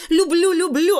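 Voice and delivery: put-on high, loud girl reporting like a soldier; eager, high-pitched child's report; rapid-fire reporting. A narrator's reading. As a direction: pipe up loudly, far above your own pitch. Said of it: "отрапортовала Таня".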